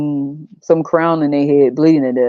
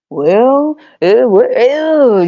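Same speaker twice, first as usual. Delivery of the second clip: stammering son; 6 semitones higher, 4 dB louder, much slower than usual. Retold or imitated. Son voiced stammering at dagger.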